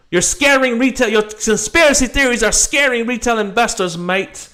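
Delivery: high, loud mock Aussie voice reading the tweet; mock Australian accent. Mocking a critic's tweet to his viewers, in a high mock Australian voice. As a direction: sneer it like a cheeky Aussie, mate. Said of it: "I see he says".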